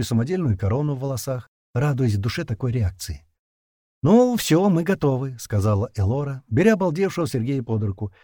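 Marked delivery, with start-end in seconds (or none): 4.0-5.4 bright announcement. Acted. Bride announcing readiness.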